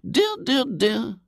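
very high-pitched pitying tutting; sympathetic lady. A narrator's reading. Lady hears William's tale, sympathetic and high. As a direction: commiserate as a high-voiced lady.

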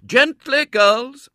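high, loud schoolmistress call; calling mistress. A narrator's reading. Mistress shepherding her girls out, calling high and loud. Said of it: "said the mistress".

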